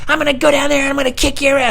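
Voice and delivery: squeaky, very high threat; high-pitched bratty imitation; threatening neighborhood kid. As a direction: threaten like an angry little boy. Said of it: "He's like".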